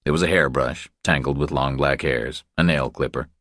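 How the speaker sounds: usual speaking voice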